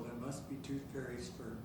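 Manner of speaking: ordinary talk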